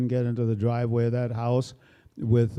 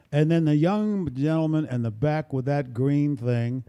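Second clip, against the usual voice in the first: normal talking voice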